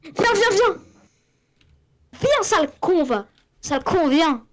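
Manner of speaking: loud, high, insistent calling; appel agacé. Real, not acted. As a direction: shout an insult-laden order to come back. Appelle son coéquipier en l'insultant. (appel crié, agacé)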